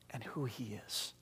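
soft, slow whispered point; hushed whisper. Real, not acted. Preacher to congregation on who Jesus is, whispered. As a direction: drop to a whisper to make it sink in. Sermon point dropped low.

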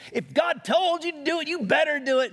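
loud, high-pitched telling-off; in-your-face scolding. Retold or imitated. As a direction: imitate a woman lighting into someone. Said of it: "she got in my face".

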